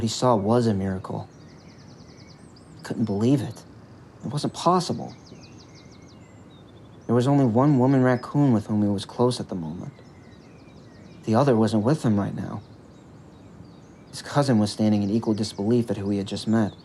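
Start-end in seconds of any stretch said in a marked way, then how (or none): none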